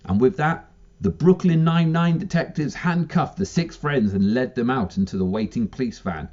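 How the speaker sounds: ordinary talk, nothing marked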